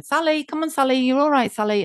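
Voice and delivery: high, coaxing call of her name; nurses calling her awake. Retold or imitated. Nurses waking a drowsy patient, high coaxing call. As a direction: rouse a groggy patient gently.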